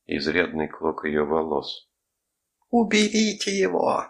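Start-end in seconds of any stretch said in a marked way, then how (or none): 2.7-4.0 high, loud panicked shriek; scream. A narrator's reading. Mother begging to be freed from her clawing child, high scream. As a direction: cry out far up in pitch. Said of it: "закричала Катерина".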